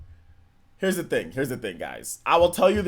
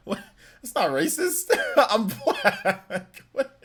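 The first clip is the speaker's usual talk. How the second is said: laughing; 8 semitones higher, much slower than usual. Real, not acted. Laughing defence.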